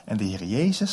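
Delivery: normal talking voice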